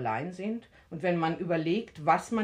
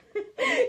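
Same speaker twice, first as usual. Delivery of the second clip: teasing laugh; 4 dB louder than usual. Acted. Nosy neighbour teasing.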